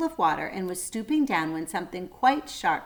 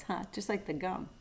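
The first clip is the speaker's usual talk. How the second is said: usual speaking voice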